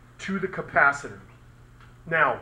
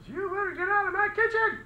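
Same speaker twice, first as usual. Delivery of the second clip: much higher, mock-scolding cook's voice; angry cook. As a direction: shoo him out of her kitchen, high and sharp. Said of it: "she was getting mad".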